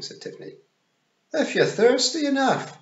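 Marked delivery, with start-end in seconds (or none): 1.3-2.8 very high, loud Scots pictsie; Daft Willie. A narrator's reading. Daft Willie answering Tiffany about drink, high Scots voice. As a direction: chip in with a high Scots pipe. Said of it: "said Daft Willie".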